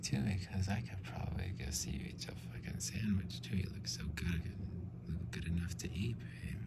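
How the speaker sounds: whispered mock Danish accent; soft Danish accent whisper; Danish accent creep whisper. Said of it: "Can I do the accent right now?".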